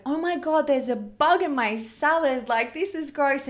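hammed-up squeal of a grossed-out teenager; overdramatic teen girl. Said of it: "she just was over exaggerating".

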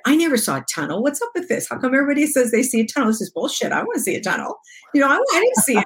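rising, quickening mock outrage; indignant complaint, pitch climbing; retold upset protest. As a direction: replay your frustration at not seeing the tunnel. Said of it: "And I was really upset".

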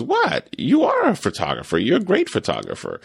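mock-incredulous, jumping high on the opening word; imitating her brushing off his modesty; insistent girlfriend. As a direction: girlfriend insisting he is a photographer, lightly voiced. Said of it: "She goes".

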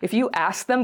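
ordinary delivery

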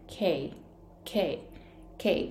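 K said short, with little puff of air; soft, clipped K in a Japanese learner's way; 短いK. Shown as a demonstration. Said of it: "Kって発音するんですよ". (息の少ない短いK)

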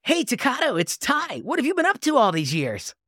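a hint of an upbeat anime hero. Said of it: "I'll do the Ty voice".

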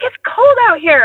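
high, whiny mock complaint; complaining partner. Partner in the chilly backyard complaining, mock whiny. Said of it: "he's like".

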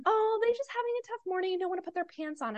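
high, soft mimicking of an excuse; mock excuse. Mom mimicking friends who cancel a play date, mock excuse.

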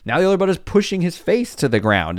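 normal talking voice

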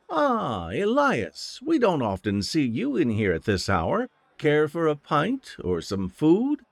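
high, bright tavern hostess voice; cheerful innkeeper woman. Acted. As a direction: play a friendly barmaid welcoming a regular. Innkeeper greets the captain.

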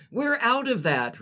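put-on high, sugary drive-thru girl; sweet voice. Drive-thru girl answers the poet, sweet and high. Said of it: "replied the girl with sweetness in her voice".

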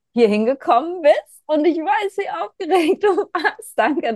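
high, loud, laughing gush; excited. Real, not acted. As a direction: gush with delight. Thanking nervous guest warmly.

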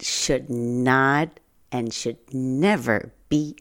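slow, weighted "should not" and "should never"; slow insistent repetition of "should not and should never"; emphatic repeat. Real, not acted. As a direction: lean hard on "should never", slowly. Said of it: "and I repeat".

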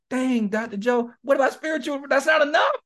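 high, loud, incredulous imitation of a client; mock exasperated client. Counselor imitating a client complaining to him, high and loud mock protest. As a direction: squeak it out like a client who can't believe it.

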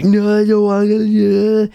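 put-on high, loud childish whine; whiny inner child. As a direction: whine like a kid refusing. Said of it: "It's just our mind saying".

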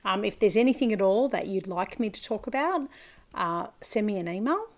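usual speaking voice

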